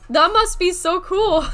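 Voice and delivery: high, loud gush between laughs; delighted. Real, not acted. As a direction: gush with giggly excitement.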